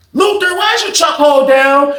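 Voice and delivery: guard shouting at an inmate through the door; officer yelling. Retold or imitated. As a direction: call him out sharply, suspicious. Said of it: "She was like".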